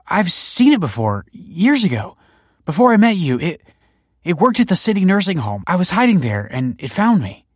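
high, loud, halting account; agitated recollection. A narrator's reading. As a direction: blurt out the memory, shaken. Shaken, recalling the machine.

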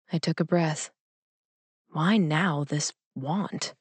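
plain reading voice

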